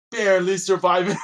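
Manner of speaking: loud and high, hammed-up; comic shout. Adventurer joking to an old friend, loud. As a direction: blurt it out big for the laugh.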